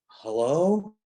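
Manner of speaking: acted-out confused hello; puzzled phone hello. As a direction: answer an unexpected call, baffled. Acts answering unexpected phone call.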